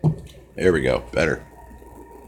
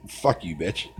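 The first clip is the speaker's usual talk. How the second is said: normal talking voice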